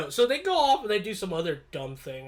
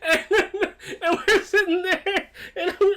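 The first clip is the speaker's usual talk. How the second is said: real laughing; 8 semitones higher than usual. Real, not acted. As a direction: talk through lingering giggles. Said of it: "I'm laughing like I am now".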